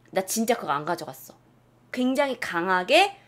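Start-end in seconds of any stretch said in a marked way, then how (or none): none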